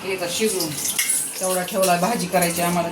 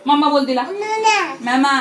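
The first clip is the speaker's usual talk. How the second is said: high, loud "say mama"; 7 semitones higher, 4 dB louder than usual. Real, not acted. Coaxing baby to say mama.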